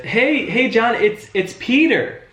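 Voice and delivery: high, bright-pitched greeting with a falling end on Peter; familiar friendly greeting. Shown as a demonstration. Sales trainer demonstrating a familiar phone intro, high and upbeat. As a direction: greet him like a long-lost best friend. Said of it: "Do you guys hear the inflection at the end there?".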